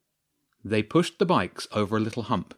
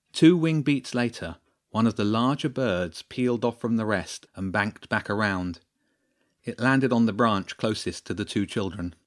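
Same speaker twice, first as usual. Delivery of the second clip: ordinary delivery, nothing marked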